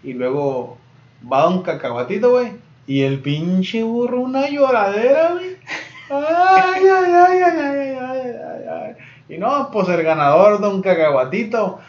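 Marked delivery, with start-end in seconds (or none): 6.1-8.9 high, loud wailing cry that fades; donkey wailing. Acted. Donkey cries after the peanut man's trick, loud wail.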